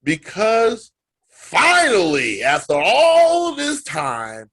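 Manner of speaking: loud, slow and drawn out; booming slow windup; dramatic emphasis. Real, not acted. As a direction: lean hard into the big reveal. Dramatic build to the reveal.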